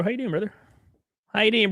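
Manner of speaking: ordinary delivery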